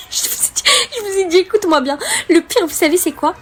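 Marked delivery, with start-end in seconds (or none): 0.1-2.0 laughing through tears. Real, not acted. Laughing and tearing up.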